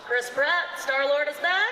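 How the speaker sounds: loud, high emcee announcement; announcing a guest. Real, not acted. Host introducing Chris Pratt to the crowd, loud and high. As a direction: hype up the guest's entrance.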